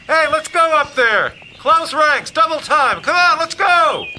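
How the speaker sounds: high, full-volume shout down a trail; hollered call. Acted. Leader to boys half a mile ahead, hollering. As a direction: holler to people far ahead. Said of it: "I cup my hands over my mouth".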